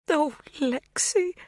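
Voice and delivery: high, slow, cracking "Oh, Lexi"; voice breaking. A narrator's reading. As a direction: let the voice crack with tears. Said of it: "Her voice breaks".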